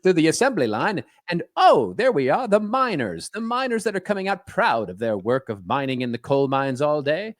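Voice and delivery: high, swooping vintage narrator; 1930s newsreel announcer. Acted. Host parodying stories about factory and mine jobs, as a newsreel announcer. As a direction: hail the workers like an old cinema bulletin. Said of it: "those nineteen thirties newsreels".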